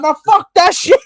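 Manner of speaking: high, loud angry scream. Retold or imitated. Retelling a friend screaming at the bad result, high loud scream. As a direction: yell it in full rage. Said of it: "He's just screaming".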